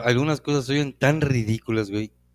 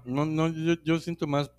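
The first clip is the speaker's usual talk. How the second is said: mouth full; 5 semitones higher than usual. Real, not acted. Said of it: "Perdón que estoy comiendo".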